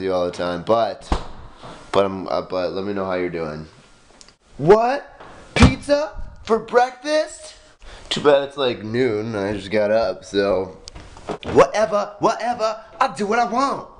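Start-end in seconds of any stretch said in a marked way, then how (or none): 4.6-7.5 high, slow astonished voice; mock surprise. 11.5-13.9 high, dismissive sing-song; sassy teen. Pretend. Mock rebellious teen.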